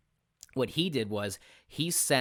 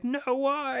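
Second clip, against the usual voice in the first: high, loud wail of fake despair; mock upset fan; 4 dB louder than usual. Fans wailing at the game master over a shocking twist, high mock despair. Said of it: "They're like".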